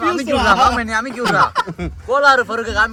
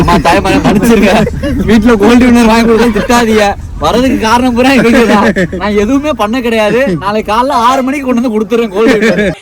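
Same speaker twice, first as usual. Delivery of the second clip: loud, speech-like apology; mock public plea; 6 dB louder than usual. Apologising to mothers about the cooking oil, loud mock plea. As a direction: project it like an address to a crowd.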